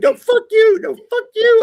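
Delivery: hammed-up high-pitched cursing fight; high mock angry squabble. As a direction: squeal it back like a spat. Retold back-and-forth cursing match.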